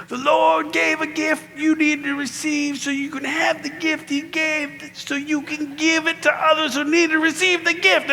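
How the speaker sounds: high, drawn-out mock-preacher chant; hammed-up preaching on one high sustained note; worked-up preacher. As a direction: intone it high and slow like a fevered preacher. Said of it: "he'd be like this".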